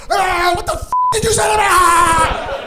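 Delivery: loud, high screaming dog voice; hammed-up outraged yelling as the dog; dog barking back. Shelter dog barking back at mom, loud high mock fury. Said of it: "immediately starts barking back".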